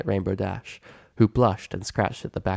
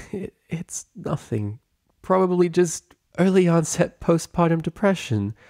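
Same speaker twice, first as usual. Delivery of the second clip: tearful; 9 semitones higher than usual. A narrator's reading. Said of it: "sound of soft weeping".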